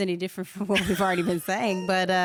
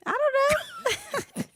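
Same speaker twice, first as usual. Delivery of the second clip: very high, slow mock innocence; playfully coy; much slower than usual. Teased by co-host about a suggestive wink, playfully coy. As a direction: play innocent, drawn out and way up high.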